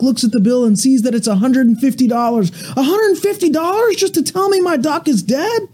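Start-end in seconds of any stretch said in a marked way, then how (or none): 2.8-5.6 shrieking woman. Acted. Duck owner outraged at the vet's bill, high mock shriek. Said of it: "she shrieks".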